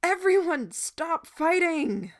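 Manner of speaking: high, loud, slow yell; howling shout. A narrator's reading. Boy shouting to the fighting crowd. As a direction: bellow it over the whole crowd. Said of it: "Gordon howled the words".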